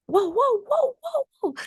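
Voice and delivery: very high wailing "waouh"; high-pitched mock sobbing cries; imitated wailing. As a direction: mimic a mother and daughter crying in high howls. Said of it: "la mère qui pleure, la fille qui pleure".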